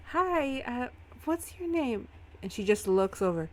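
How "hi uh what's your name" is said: high-pitched, tentative hello; friendly in-character greeting. Acted. Nevin approaching a supposed stranger, high polite greeting.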